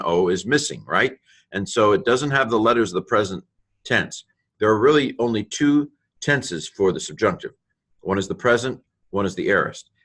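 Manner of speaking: usual speaking voice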